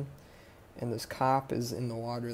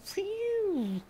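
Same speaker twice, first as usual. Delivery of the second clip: high siren whistle sliding down at the end; long high siren noise; imitated police siren. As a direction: mimic a siren with a long piercing "pheeew". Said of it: "pops on the siren".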